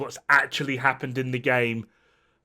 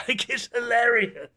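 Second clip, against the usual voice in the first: laughing incredulously; 8 semitones higher than usual. Real, not acted. Laughs at the absurd story.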